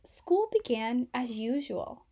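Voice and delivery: ordinary delivery, nothing marked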